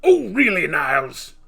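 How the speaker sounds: hammed-up indignant snob; Frasier Crane impression. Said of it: "Fraser Crane".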